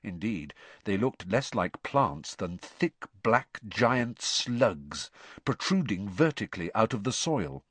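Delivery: ordinary delivery, nothing marked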